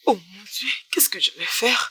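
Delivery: unvoiced, frightened whisper; scared girl whispering alone; panicked whisper.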